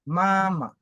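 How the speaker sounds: MAMA with first syllable held high and level, then dropping; first-tone example. Shown as a demonstration. Teacher giving a first-tone word, MAMA high and drawn out. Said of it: "Comme un exemple de ma".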